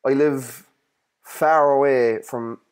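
normal talking voice

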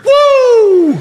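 high wild Ric Flair woo; shrieked wrestler's woo. Retold or imitated. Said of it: "the home of Ric Flair".

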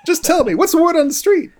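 high, loud hammed-up film noir star; old Hollywood star. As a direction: plead like a faded movie star. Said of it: "It's like those".

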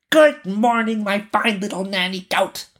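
put-on very high singsong child's greeting; robber girl teasing. Robber girl greets her mother, teasing high voice. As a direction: pipe it up high, cheeky and drawn along.

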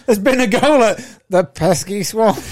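mock news announcer. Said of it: "Imagine that on Sky Sports News".

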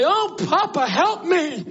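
high-pitched desperate holler; acted plea hollered high; hollering for Papa. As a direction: cry out to your papa for help. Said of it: "I'd holler for Papa".

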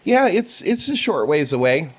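put-on high, eager little kid voice; child voice. GM voicing a half-orc kid to the players, high childlike. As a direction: chirp it out like a curious small boy. GM as Bug.

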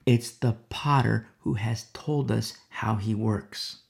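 ordinary delivery

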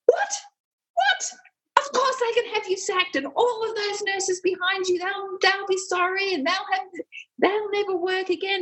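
high-pitched, incredulous outrage, then threats; mock frustrated rant. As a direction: imitate a surgeon losing his temper. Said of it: "he was so frustrated".

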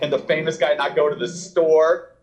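high, playful ribbing; mocking teasing. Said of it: "I kinda joked and made fun of him".

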